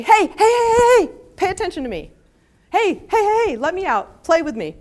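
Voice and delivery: high, loud yappy "hey hey hey"; be a pushy dog begging for attention; insistent puppy pestering its owner; demanding dog voice. Retold or imitated.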